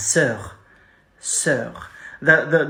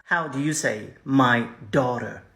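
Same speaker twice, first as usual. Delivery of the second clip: ordinary delivery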